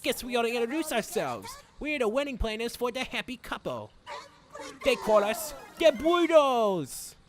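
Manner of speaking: put-on theatrical would-be Irish accent; put-on henchman accent. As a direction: introduce the gang in a would-be Irish accent, building to a loud finish. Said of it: "snap in and out of the accent".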